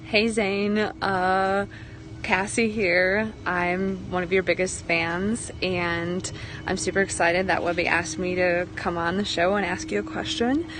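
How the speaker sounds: ordinary talk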